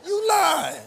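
sharp high shout calling them out. Real, not acted. Preacher shouting at lying congregation, loud. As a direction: yell it right at the crowd.

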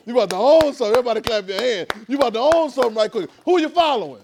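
high, loud, pushing the crowd to answer; loud preaching call. Real, not acted. Preacher calling the congregation to raise hands, loud and high. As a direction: call out to the room and demand hands.

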